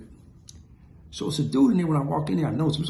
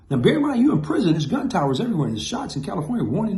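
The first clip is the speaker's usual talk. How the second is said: ordinary talk, nothing marked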